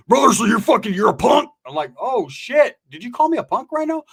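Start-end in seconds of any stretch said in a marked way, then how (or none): 0.1-1.5 loud, high-pitched accusing shout; angry challenge. Retold or imitated. Retold buddy challenging him as a punk. 2.0-4.0 high-pitched disbelieving question; incredulous comeback. Retold or imitated. Retold reaction to being insulted.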